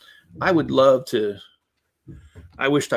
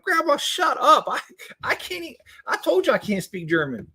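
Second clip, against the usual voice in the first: mock protest; 13 semitones higher than usual. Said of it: "And I'm like".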